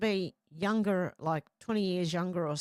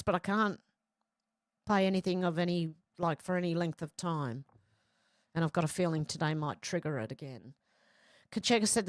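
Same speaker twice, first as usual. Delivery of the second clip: ordinary delivery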